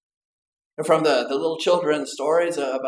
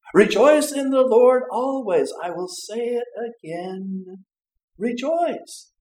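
proclaiming scripture; 8 semitones higher, 4 dB louder, slower than usual. Acted. Quoting scripture with fervor.

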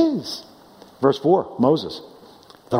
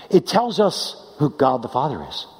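usual speaking voice

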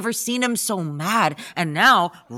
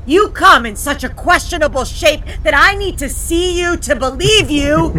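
loud theatrical declaiming; hammy stage actor; 3 semitones higher, 6 dB louder than usual. Acted. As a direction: play it like a stage Hamlet. Hamlet speech, louder.

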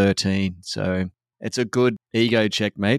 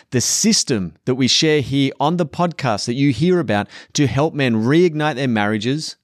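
upbeat promo read, a bit higher and brighter in energy; podcast sponsor read; 5 semitones higher, 4 dB louder than usual. Acted. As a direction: sell the masterclass like a host ad spot.

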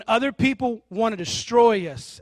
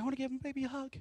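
high, soft doting mom voice; 7 semitones higher, 9 dB quieter, much faster than usual. Acted. Mom wanting to hug her kid at school, doting. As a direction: imitate a mom cooing over her baby. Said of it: "and she's like".

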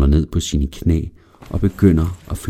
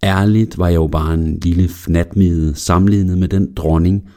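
usual speaking voice

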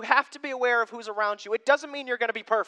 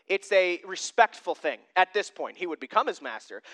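ordinary delivery

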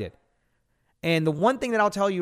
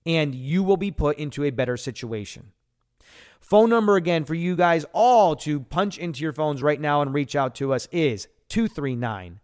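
usual speaking voice

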